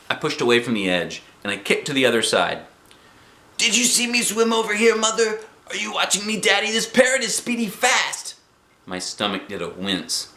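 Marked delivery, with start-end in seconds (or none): 3.6-8.4 high, excited showing off; calling out. A narrator's reading. Girl shows parents her swimming, calling out. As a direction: call across the water, pitched way up.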